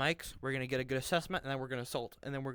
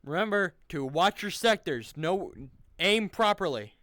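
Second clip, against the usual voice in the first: loud, raised-pitch correction; shouted reminder; 5 semitones higher, 8 dB louder than usual. Real, not acted. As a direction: call it out sharply. Correcting squad's aim.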